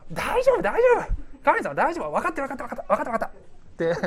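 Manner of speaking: very high brush-off, falling away to rapid assent; mock dismissive. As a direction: cut off advice with breezy, impatient reassurance. Acts listener brushing God off. (甲高く軽く受け流す口調で)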